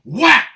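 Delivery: shouted mock slap noise; loud whack sound effect. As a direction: yell the hit like a cartoon. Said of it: "I'd have been like".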